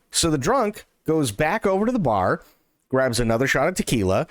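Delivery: normal talking voice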